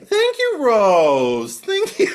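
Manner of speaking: very high, loud sing-song thanks; preening diva. Retold or imitated. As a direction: purr thanks like a vain queen. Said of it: "diva thanking servant".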